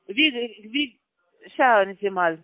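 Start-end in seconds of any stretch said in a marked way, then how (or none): none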